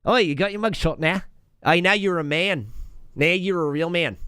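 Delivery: hammed-up Aussie congratulations; mock Australian accent. Australians greeting arrest as rite of passage, mock Australian accent. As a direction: rib a mate in an Aussie voice. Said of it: "getting arrested in Australia is just like".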